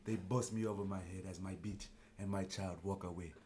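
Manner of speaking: low, dark mock African accent for a captured tribesman; lightly put-on African accent telling of capture. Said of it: "you can do an accent".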